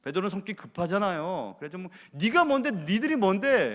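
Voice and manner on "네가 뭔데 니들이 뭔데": loud, high mock challenge as hot-tempered Peter; imagined defiant retort. Peter talking back to his accusers, mock-angry and loud. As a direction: fire it back like a quick-tempered man. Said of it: "막 대들어야 되는데".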